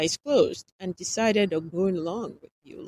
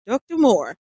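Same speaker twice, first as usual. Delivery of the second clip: calling out; 5 semitones higher, 12 dB louder than usual. A narrator's reading. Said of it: "Nathan called".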